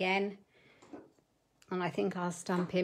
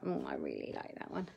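breathy whisper, much lower and softer; whispered thought; 10 dB quieter than usual. Retold or imitated. Crafter whispering her own reaction to the hedgehog stamp. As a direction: hush it like a private thought. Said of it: "and I was like".

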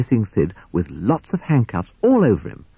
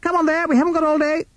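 very high, hurried bird voice; fast, high-pitched police sparrow; shouting sparrow constable; faster than usual. A narrator's reading. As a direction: hustle them along in a high squeak. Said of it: "shouted P C Sparrow".

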